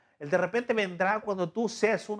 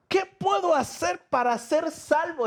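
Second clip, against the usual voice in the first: much higher, pleading jailer's question; desperate jailer; 10 semitones higher than usual. Acted. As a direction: beg for salvation in the jailer's voice. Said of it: "está diciendo el carcelero". (el carcelero suplica, mucho más agudo)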